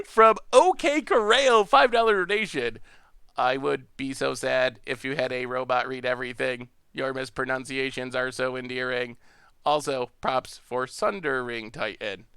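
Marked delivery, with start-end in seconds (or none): 3.4-12.3 monotone robot read-out, lower and softer. Pretend. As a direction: read it like a robot, every word on one even pitch. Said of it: "read them in a robot voice".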